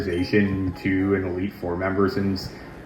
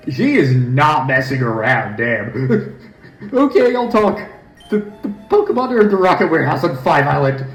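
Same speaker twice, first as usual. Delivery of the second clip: high, giggly villain grunt; laughing goon voice; 8 semitones higher, 3 dB louder than usual. Acted. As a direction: confess with a nervous cackle. The beaten grunt confesses.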